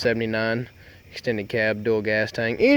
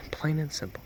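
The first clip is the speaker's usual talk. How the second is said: "Plain and simple" breathed as a whisper; 3 semitones higher, 10 dB quieter than usual. Acted. Truck guy whispering his tagline to the viewers. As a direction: hush it like a secret.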